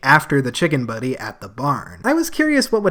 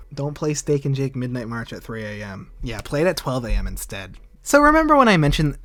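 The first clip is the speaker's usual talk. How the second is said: ordinary delivery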